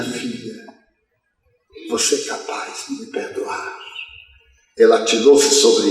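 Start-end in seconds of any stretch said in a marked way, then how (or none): 0.0-3.8 faint, unvoiced words from a dying man; dying father whispering. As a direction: let the voice fade to a whisper. (pai moribundo sussurrando)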